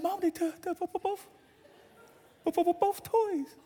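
hammed-up kid complaining; mock wail.

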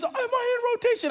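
very high-pitched pleading question; mock anxious squeal. Player finally asks the right coach, mock anxious squeal. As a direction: imitate a flustered player squeaking his question.

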